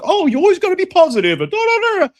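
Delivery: high, far-off mocking whine of a loser. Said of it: "I hate people that are fucking losers and like".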